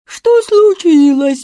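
loud, high, drawn-out mocking echo; mimicking. A narrator's reading. Ludwig mimics sleepy Jacob, loud mocking. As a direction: exaggerate the slow sleepy question in mockery. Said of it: "передразнил его Людвиг".

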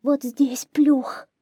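high, slow, frightened whimper; trembling hare. A narrator's reading. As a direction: point it out as a terrified hare. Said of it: "дрожа всем телом". (заяц дрожит от страха)

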